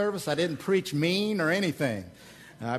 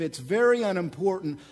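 ordinary delivery

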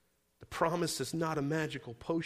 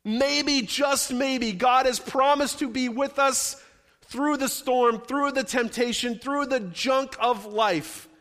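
higher, louder, ringing promise; loud preaching; 7 semitones higher, 7 dB louder, slower than usual. Acted. Pastor promising the church God stays with them, loud and high. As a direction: proclaim it to the back row.

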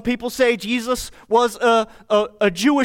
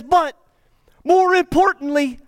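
But more importantly rises high and loud, punching importantly; emphatic build; 9 semitones higher, 4 dB louder than usual. Retold or imitated. Preacher voicing Jesus to disciples, high loud emphatic stress. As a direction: lean hard on more importantly, raising the voice.

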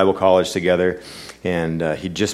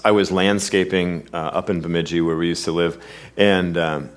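normal talking voice

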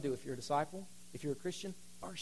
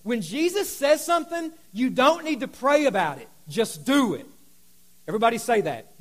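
ordinary delivery

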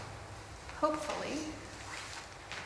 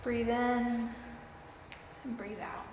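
guided meditation; 3 dB quieter than usual. Acted.

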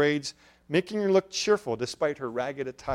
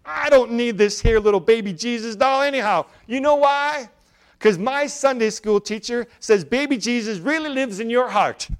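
put-on high, loud bright little girl's voice; excited child; 7 semitones higher, 7 dB louder than usual. A narrator's reading. Little girl tells her mother about baby Jesus, high excited child voice. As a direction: proudly share what the teacher said, like a small girl. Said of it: "she announced excitedly".

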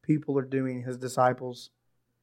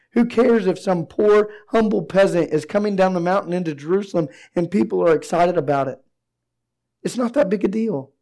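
higher, louder, pressing questions; preaching at full force; 5 semitones higher, 6 dB louder than usual. Acted. Pastor challenges congregation with rhetorical questions, loud and high. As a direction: drive each question home to the room.

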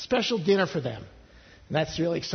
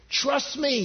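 insistent; 9 semitones higher than usual. Acted. God answering the objecting people.

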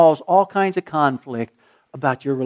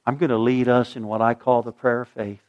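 normal talking voice

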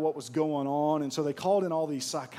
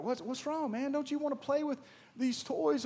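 gentle coaxing; 10 semitones higher than usual. Retold or imitated. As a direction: coax the boy kindly, pitched up. Psychiatrists coaxing crying boy.

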